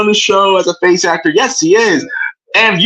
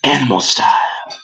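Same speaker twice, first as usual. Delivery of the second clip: villain voice; 12 semitones lower, 5 dB quieter than usual. Pretend. Villain voice, whispered punchline.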